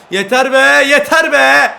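high, loud enraged yell; loud furious cry of enough; furious shout. Real, not acted. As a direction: explode at the referee. Angry at offside.

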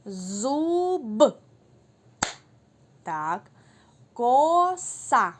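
each word called out loud and clear; emphatic loud teacher word calls; loud word dictation. Shown as a demonstration. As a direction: call words so children can clap on the b. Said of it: "послухайте, в яких же словах".

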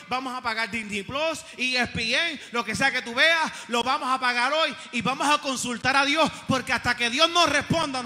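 loud, rallying declaration; full-force preaching. Acted. Preacher voicing a family leader calling a fast, loud and rallying. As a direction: rattle off the vows loudly, driving hard.

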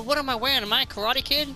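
usual speaking voice

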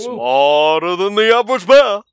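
lightly voiced cartoon bear; a hint of Yogi Bear. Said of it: "sound like Yogi Bear".

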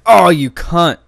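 loud furious outburst; angry shout. Real, not acted. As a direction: yell at the screen. Killed in online game.

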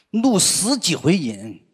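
ordinary talk, nothing marked

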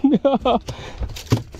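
high laugh tailing downward; elated laugh. Real, not acted. Angler celebrating a strike, elated laughing. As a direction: burst out in a high, happy laugh.

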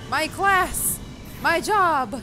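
high, loud exclamation; two punched, high-pitched shouts of delight; excited cry. Real, not acted. Player shouting at seeing her class in the game, excited cry.

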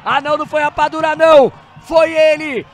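screaming the goal call, high and loud; explosive goal commentary. Real, not acted. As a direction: erupt with joy, stretching the words. Goal scored, narrator erupts.

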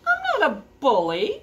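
high troll protest; offended troll. Acted. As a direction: deny it as an affronted storybook troll. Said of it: "said the troll".

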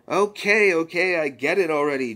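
put-on teen sister who has had enough; annoyed Summer. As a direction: snap at them, fed up. Summer annoyed at Rick and Morty.

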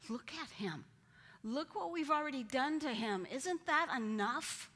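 desperate governor crying out; pleading Pilate. Acted. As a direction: beg the crowd to see him.

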